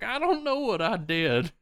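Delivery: high-pitched frightened protest; spooked henchman. Acted. First man answering the ghost: high scared whine. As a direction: plead it high, comically spooked.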